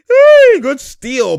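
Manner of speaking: loud, high excited shout; excited yell. Real, not acted. Cheering a steal, loud and high. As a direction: yell in delight.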